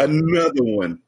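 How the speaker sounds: booming "Another one" catchphrase; [name] voice. Shown as a demonstration. Echoing the host with a [name] impression. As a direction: drop it like [name]'s tag line. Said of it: "That's my, uh, D J Khaled voice".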